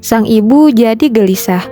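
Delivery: ordinary delivery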